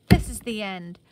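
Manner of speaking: loud, booming echo of the shutters; shutters seem to say. A narrator's reading. Slamming shutters echo through the empty house, booming. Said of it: "it seemed to say".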